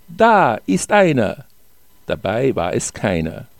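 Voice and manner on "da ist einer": higher, lightly excited call; calling out a find. A narrator's reading. Mushroom hunter announcing a find to companions, excited call. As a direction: call out a spotted mushroom, pitched up.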